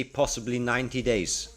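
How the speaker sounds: ordinary talk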